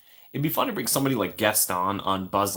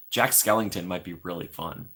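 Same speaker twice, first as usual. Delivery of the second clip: usual speaking voice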